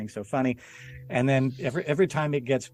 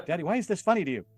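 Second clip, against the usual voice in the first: put-on higher, quick child's question; lightly voiced puzzled child, pitched up; puzzled kid; 7 semitones higher, faster than usual. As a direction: imitate a kid quizzing a parent. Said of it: "don't watch it with your kid".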